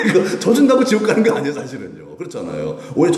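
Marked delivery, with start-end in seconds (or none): 0.1-1.5 high, loud and laughing; laughing, emphatic reassurance; amused assertion. Real, not acted. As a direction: insist through a laugh. Answers own question after laughing.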